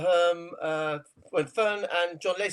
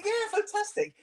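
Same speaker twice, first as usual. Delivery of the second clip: much higher, gushing yeah fantastic; a hammed-up cheerful reply; mock delight; 13 semitones higher than usual. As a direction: play up the delight. Retold text reply, acted delight.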